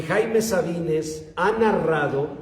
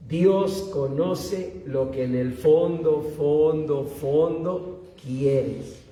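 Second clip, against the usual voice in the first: each fondo slower and heavier, the last one drawn right out; repeated emphasis; 3 semitones lower, much slower than usual. Acted. Preacher to the youth, drawing out "fondo" slowly. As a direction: sink deeper with every "fondo", stretching the final "fondo quieres" very slowly.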